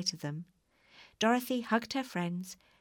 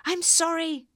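high, loud call from a distance; shouted apology; 10 semitones higher, 7 dB louder than usual. A narrator's reading. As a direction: shout it down to someone below. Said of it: "shouted the balloonist".

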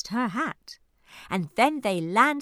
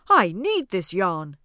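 loud cry; 3 semitones lower, 6 dB louder than usual. Acted. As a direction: call it out loud. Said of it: "cries Ernie".

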